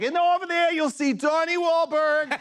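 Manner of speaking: high, loud, drawn-out tour announcer; tour guide on speaker. Retold or imitated. Guide hollering to tourists over boat speakers, mock announcer. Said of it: "they have a speaker system on".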